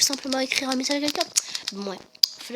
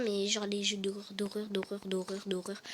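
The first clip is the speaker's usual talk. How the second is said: whispering; 5 semitones lower, 8 dB quieter than usual. Real, not acted. Stressing how scary horror games are.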